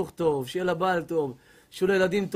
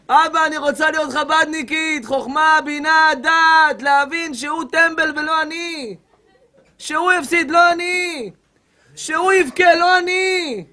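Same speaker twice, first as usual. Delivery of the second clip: a hint of a whiny woman praying, higher and building louder; mock prayer; 5 semitones higher, 4 dB louder than usual. Rabbi mocks a girl's prayer to God, rising whiny plea. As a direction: ham up her petition to God.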